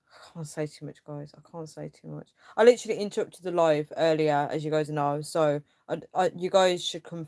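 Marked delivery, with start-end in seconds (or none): 0.1-2.3 very soft, guarded aside; confiding. Real, not acted. Holding back private trouble from viewers.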